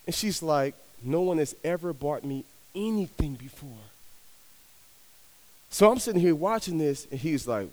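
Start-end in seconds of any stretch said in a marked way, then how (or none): none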